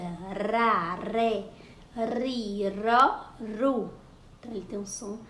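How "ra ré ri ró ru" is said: "ra, ré, ri, ró, ru" chanted one by one, tapped R; vibrating soft R. Shown as a demonstration. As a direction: demonstrate the vibrating R in "ra, ré, ri, ró, ru". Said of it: "Ele vibra". ("ra, ré, ri, ró, ru" com R brando, vibrado)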